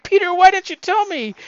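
high, loud mock scolding; loud, high-pitched imitation of astonishment; acted excited reproach. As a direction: exclaim it as a thrilled, indignant aunt. Voices aunt's reaction to pregnancy news.

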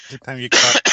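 forced rough cough; a deliberate fake cough; coughing fit. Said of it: "I'll talk in a coughing voice".

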